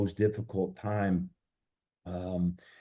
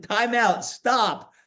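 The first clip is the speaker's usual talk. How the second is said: high, louder mock "time out, stop"; 4 dB louder, much slower than usual. Retelling how he stopped his wife mid-rant, high mock call. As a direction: call a half-joking time out. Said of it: "I finally said".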